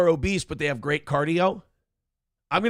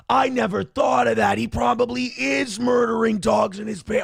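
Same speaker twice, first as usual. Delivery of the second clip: imitating Worski's hype; much slower than usual. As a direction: gasp and egg him on like a hype man. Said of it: "And Worski will go".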